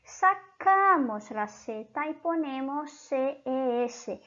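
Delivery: sacamos la Z y ponemos C E S spoken slowly, letter by letter; teacher dictating the letters slowly; slow spelled-out rule. Shown as a demonstration. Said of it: "Cuando una palabra termina con una Z".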